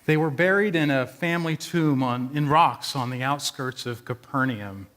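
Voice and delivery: ordinary talk, nothing marked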